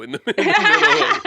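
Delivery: high, loud laughing while talking. Real, not acted. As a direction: crack up mid-sentence. Laughing hard.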